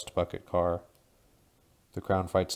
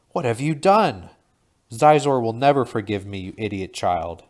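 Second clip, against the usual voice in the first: louder, higher raging crown; furious scream; 4 semitones higher, 10 dB louder than usual. A narrator's reading. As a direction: scream at the foolish boy. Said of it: "his crown screams".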